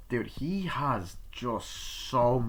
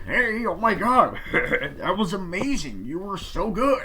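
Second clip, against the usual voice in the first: much higher, slow, laughing rave; gushing praise; 10 semitones higher, 7 dB louder, slower than usual. Retold or imitated. Uncle gushing to the boy after the premiere, high and laughing. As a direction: gush with delighted laughter.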